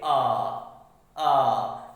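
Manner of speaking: आ as a long open "aah"; pronunciation demo. Shown as a demonstration. Teacher answering how to say O, long open आ. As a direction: hold आ open and let it sound out. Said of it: "इसको कैसे बोलेंगे?".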